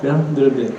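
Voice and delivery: ordinary talk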